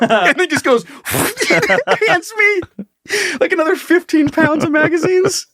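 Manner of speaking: high, laughing retelling. Real, not acted. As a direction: laugh through the story.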